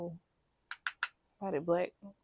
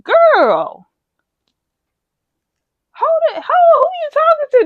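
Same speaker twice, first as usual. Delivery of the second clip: much higher, louder indignant shout; clapback; 12 dB louder, slower than usual. Retold or imitated. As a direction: snap back at the boss. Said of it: "I'm like".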